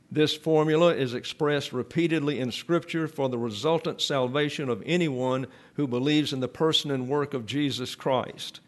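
ordinary delivery

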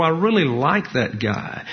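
ordinary talk

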